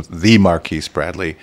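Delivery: "the" said slowly as "thee", like a title; stress on "the". Shown as a demonstration. Host repeating his guest's grand title, stress on "the". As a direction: lean on a long "the" before Marquise Bradley. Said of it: "when the word "the" came into play".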